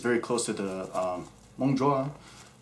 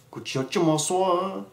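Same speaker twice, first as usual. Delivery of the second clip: put-on voice; 8 semitones higher, slower than usual. Voiced Hmong phrase.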